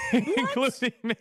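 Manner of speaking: admitting it through a laugh. Real, not acted. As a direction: laugh the words out at yourself.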